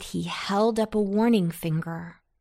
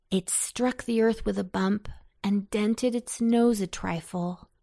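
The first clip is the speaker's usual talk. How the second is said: ordinary delivery, nothing marked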